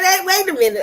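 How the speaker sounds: high, loud protest after a laugh; wait a minute. Retold or imitated. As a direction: push back with amused disbelief. Retelling her pushback, laughing.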